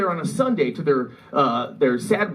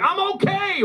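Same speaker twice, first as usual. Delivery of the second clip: very high, drawn-out proclamation; sing-out high pitch, hammed-up; mock cheerful announcement; slower than usual. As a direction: imitate dad popping up triumphantly. Dad announces he survived.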